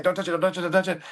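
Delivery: high, urgent repeated shout; repeated retold yell. Coach yelling to her pitcher again and again, high urgent warning. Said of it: "I'm yelling at my pitcher".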